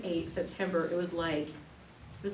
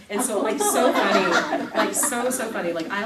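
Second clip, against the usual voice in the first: laughing; 2 semitones higher, 10 dB louder than usual. Real, not acted. Laughing at funny reviews.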